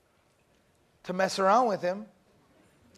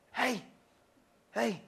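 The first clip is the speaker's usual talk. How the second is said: whisper; 8 dB quieter than usual. Retold or imitated. Classmate whispering.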